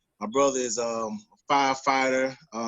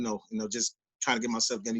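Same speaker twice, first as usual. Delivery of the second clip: normal talking voice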